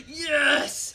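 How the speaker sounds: loud, high triumphant yes; excited cheer. Real, not acted. Player cheering a catch in the game, excited cheer. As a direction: burst out with a gleeful yell.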